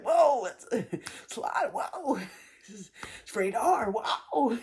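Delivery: high whoas broken up by laughter; laughing disbelief. Retold or imitated. Retelling her reaction to his crude texts, laughing. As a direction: laugh through it in shock.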